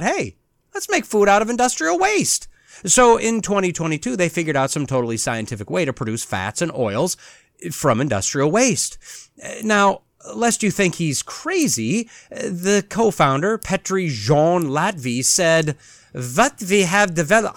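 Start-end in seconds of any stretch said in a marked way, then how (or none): 0.0-2.5 mock bright idea. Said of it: "some Estonian got a bright idea and thought". 16.1-17.5 put-on tentative Estonian accent, w in we said as v; a quick stab at an Estonian accent; attempted Estonian accent. Quoting the Estonian co-founder in a guessed Estonian accent. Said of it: "I don't actually know what an Estonian accent sounds like".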